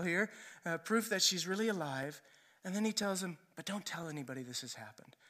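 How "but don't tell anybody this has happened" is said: low, quick and hushed; soft, hurried instruction to keep quiet; confidential. Retold or imitated. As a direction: slip it out like a secret. Jesus asks family to keep secret.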